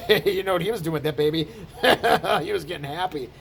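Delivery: mock dirty-minded chuckle through the words; leering snicker. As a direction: snicker as if sharing a dirty secret. Mocking leering viewer with twisted mind.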